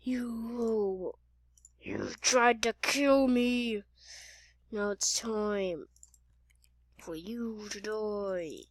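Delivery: slow, drawn-out, hushed menace; low, creeping villain drawl; sinister threat. Acted. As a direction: stretch every word into a death threat. Slow acted death threat.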